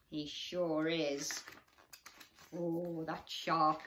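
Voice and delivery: usual speaking voice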